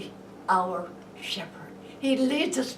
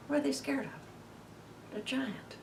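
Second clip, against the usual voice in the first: slow, soft, half-whispered question; suspenseful hush; 3 semitones higher, 8 dB quieter than usual. Acted. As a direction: hush it like a secret. Storytelling suspense.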